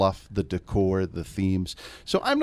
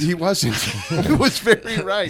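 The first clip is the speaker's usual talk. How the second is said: laughing; 10 semitones higher, 5 dB louder than usual. Real, not acted. Laughing at his own costume story.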